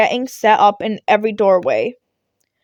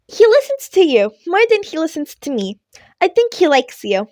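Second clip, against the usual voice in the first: higher, louder voice for the cheerful principal; principal Nezu; 6 semitones higher, 3 dB louder than usual. A narrator's reading. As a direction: lift the voice up for the small principal.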